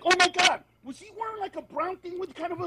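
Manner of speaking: high, hushed catty comedian gossip; whisper-gossip like a bitchy comic; gossipy comedian. Retold or imitated. Comedians dishing about the guy who didn't laugh, high and hushed. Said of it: "the other comedians will be like".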